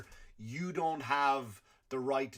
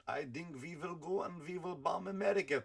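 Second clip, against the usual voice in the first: mock Russian accent; 3 semitones higher, 4 dB quieter than usual. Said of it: "great for Russia".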